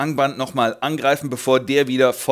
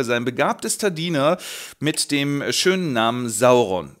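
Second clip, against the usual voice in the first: ordinary talk, nothing marked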